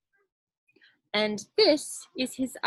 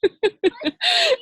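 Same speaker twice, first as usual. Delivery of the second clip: high loud laugh; real high burst of laughter; 8 dB louder than usual. Real, not acted. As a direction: laugh out at her own joke. Laughs at own joke.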